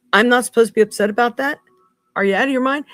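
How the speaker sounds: furious vlogger about puppy testing; outraged. Real, not acted. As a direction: let the outrage spill over.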